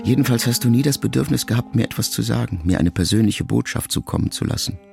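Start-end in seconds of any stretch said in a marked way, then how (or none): none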